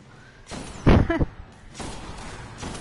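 airy laugh at himself; quick unvoiced chuckle; breathy laugh. Real, not acted. Laughs at own stumble.

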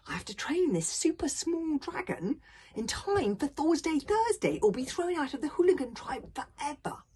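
put-on loud, high, exasperated boy; high indignant complaint; cross. A narrator's reading. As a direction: pile up your troubles crossly. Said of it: "said Hiccup crossly".

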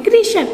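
कृषक pitched high and falling, "krishak"; modelling the word. Shown as a demonstration. Teacher showing children how to say the word, high and falling. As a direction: call out कृषक high, then let it fall. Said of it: "तो उसको हम क्या बोलते हैं?".

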